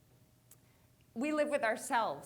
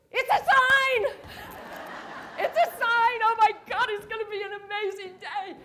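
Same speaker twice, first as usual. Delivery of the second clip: excited; 8 semitones higher, 11 dB louder than usual. Retold or imitated. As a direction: cheer like fate just winked at you. Bottle lands in the can.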